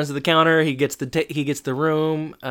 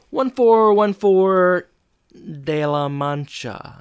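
higher, louder, slow grandiose delivery; mock grand address; 6 semitones higher, 4 dB louder, slower than usual. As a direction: announce the fake address with pomp. [name] giving a fake address.

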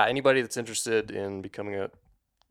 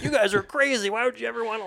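high-pitched mock disbelief; put-on high voice, playfully incredulous; incredulous young listener; 14 semitones higher, 4 dB louder than usual. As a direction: imitate an aghast twenty-year-old, pitched way up. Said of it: "twenty years old and is li".

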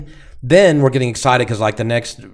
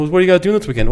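higher-pitched quoted coworker, lightly voiced; imitating a coworker; 8 semitones higher, much faster than usual. Co-worker asking the hosts about weekend plans, lightly imitated. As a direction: lightly put on a higher chatty voice. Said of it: "Carol's going".

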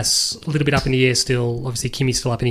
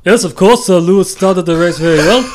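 loud, higher put-on Austrian boss voice; Niki Lauda impression; 5 semitones higher, 7 dB louder than usual. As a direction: bark it out like Niki Lauda with a heavy accent. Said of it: "I'll try to answer it as Niki Lauda".

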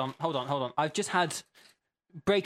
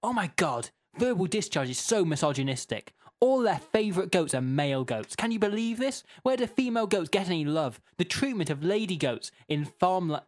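sort of shouty; 4 semitones higher than usual. Acted. Host reading Jean's all-caps complaint normally, sort of shouty. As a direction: own accent, mildly shouty indignant read. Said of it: "but sort of shouty".

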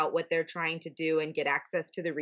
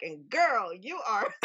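mock Australian accent; 3 semitones higher, slower than usual. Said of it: "In his Australian accent".

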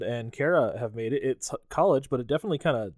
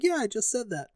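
high, smug retort; mock snark; 9 semitones higher than usual. Tommy answering Loomis with a high smug retort. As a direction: sneer it back, pitched up. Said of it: "he's like".

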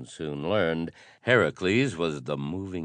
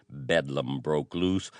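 ordinary delivery

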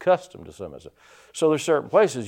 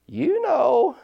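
parrot voice; 11 semitones higher, much slower than usual. Retold or imitated. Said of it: "that parrot looks at him and says".

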